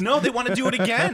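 high, loud denial through real laughter; laughing protest. Real, not acted. Host answering co-host's jab about the sponsor, laughing protest. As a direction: protest while cracking up.

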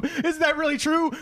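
high, incredulous mock questioning; high-pitched, drawn-out comic disbelief; acted film dialogue.

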